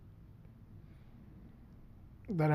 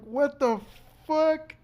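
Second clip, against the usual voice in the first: rising high, louder shocked cry; shocked disbelief; 10 semitones higher, 3 dB louder, much slower than usual. Retold or imitated. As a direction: climb up in pitch with disbelief. Retold disbelief during crash.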